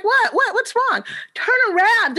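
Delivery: high startled what, what's wrong; retold startle at the kids, pitched high. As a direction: snap round in alarm. Startled by classroom surprise.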